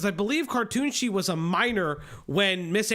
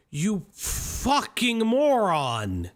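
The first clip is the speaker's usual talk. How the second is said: long, drawn-out exasperation; exasperated insult; much slower than usual. Real, not acted. Host calling Jar out as a moron, exasperated. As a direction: stretch every word out slowly.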